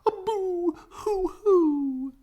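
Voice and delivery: high, very slow boo-hoo crying; hammed-up sobbing. A narrator's reading. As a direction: wail it like a cartoon sob. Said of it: "sobbed the dogs".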